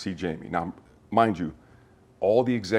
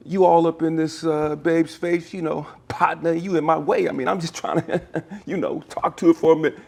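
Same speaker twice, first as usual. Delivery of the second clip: imitating Jamie's hustler voice; 9 semitones higher, 5 dB louder than usual. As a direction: ham up a smooth-talking hustler cutting in. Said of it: "And he said".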